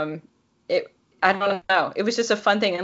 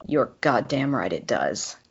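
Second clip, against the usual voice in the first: lower, slow, dark male voice; slow low menace; 6 semitones lower, 3 dB quieter, slower than usual. A narrator's reading. Will inches from her face, slow and low. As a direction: lean in and say it slowly, inches away. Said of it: "as he said slowly".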